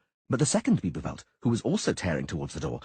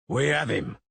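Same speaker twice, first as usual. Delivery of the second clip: half-hearted mock yell; mild yell; 3 semitones higher, slower than usual. A narrator's reading. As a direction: call out, not fully. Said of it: "the masons yelled".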